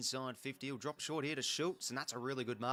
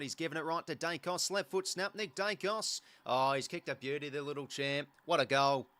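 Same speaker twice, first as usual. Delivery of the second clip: excited goal call; 7 dB louder than usual. Real, not acted. Commentator calls Dacos kicking a beauty, real excitement.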